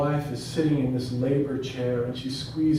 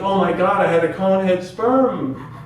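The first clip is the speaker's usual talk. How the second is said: louder, higher horrified exclamation; comic dismay; 4 semitones higher, 7 dB louder than usual. Retold or imitated. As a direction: blurt out the alarmed realization. Comic horror at baby's head.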